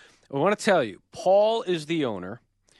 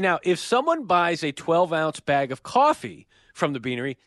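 upbeat sponsor read, deliberately emphatic; 4 semitones higher than usual. Acted. Host pitching the sponsor's new drink, announcer style. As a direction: sell it like a radio announcer.

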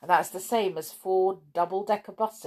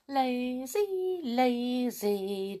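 lazy drawl; 7 semitones higher, much slower than usual. Acted. Savouring National Lazy Day.

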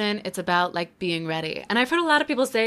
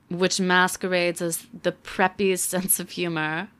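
normal talking voice

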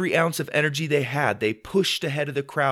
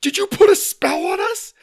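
put-on very high, loud kid accusation; frantic boy's yelp; alarmed cry; 8 dB louder than usual. A narrator's reading. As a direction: squeal it in alarm. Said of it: "cried Sawyer".

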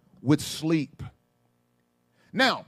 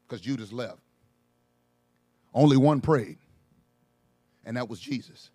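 much softer, lower, intimate sermon; soft reflective preaching; 11 semitones lower, 8 dB quieter than usual. Acted. Preacher quieting down to let the point land, soft. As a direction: draw them in with a hush.

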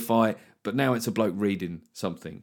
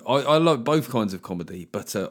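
ordinary delivery

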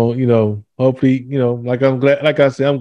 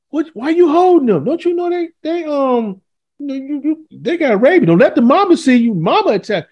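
mock alarmed man; 11 semitones higher than usual. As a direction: warn the kids high and urgent about rabies. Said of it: "the brother like".

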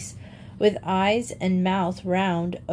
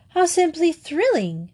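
thrilled; 8 semitones higher, 3 dB louder than usual. A narrator's reading. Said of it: "gasped Grizel".

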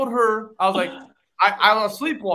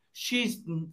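ordinary talk, nothing marked

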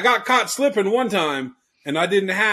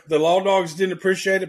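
ordinary delivery

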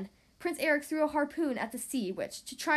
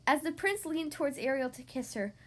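ordinary delivery, nothing marked

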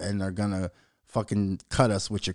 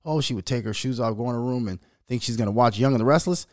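usual speaking voice

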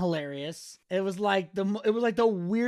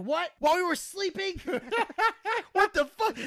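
mock Johnny outburst; 9 semitones higher than usual. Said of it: "Johnny's like".

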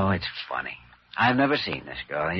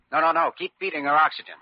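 urgent no; 9 semitones higher, 4 dB louder, faster than usual. Acted. Doctor urgently stops the others.

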